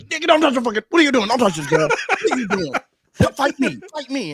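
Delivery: high, loud, hammed-up challenge; wound-up bar hero shouting. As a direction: yell like a guy defending a stranger. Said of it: "And they're like".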